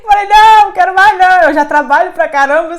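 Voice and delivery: high, loud exclamation of refusal; playful loud refusal; mock refusal. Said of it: "eu falei, parei".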